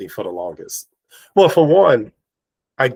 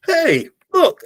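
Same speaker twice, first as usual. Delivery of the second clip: much higher, louder excited call; thrilled to see a match; excited hey look; 5 dB louder than usual. Retold or imitated. Substitute teacher greeting the narrator, a high excited call. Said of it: "she was like".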